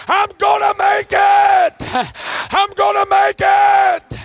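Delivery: slow, full-force preaching. Acted. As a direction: shout the testimony home. Preaching to the congregation.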